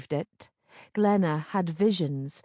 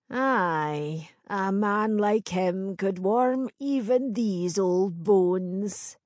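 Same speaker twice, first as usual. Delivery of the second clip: put-on old crone voice; much slower than usual. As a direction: speak like an old village crone.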